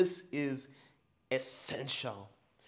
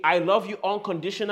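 loud declaration; 5 semitones higher, 9 dB louder, faster than usual. Shown as a demonstration. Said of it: "As loud as you can".

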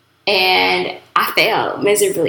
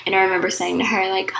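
ordinary delivery

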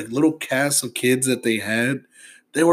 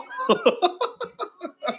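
high burst of laughter; 4 dB quieter than usual. Real, not acted. Laughs at old movie.

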